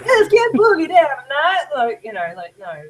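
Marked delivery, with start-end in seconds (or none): 0.0-1.6 high hammed-up Southern accent. Shown as a demonstration. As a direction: overdo it as a high-pitched Southerner. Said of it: "the stereotypical".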